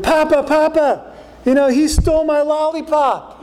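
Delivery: put-on high, whiny kid tattling to papa; whiny child. Child runs to papa complaining a sibling stole his lollipop, whiny high voice. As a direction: play a small child telling on a sibling.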